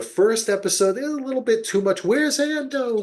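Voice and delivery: high, fast mocking voice for the characters. Fan mocking the show's clunky exposition, high squeaky mock voice. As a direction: parody the needy characters calling out.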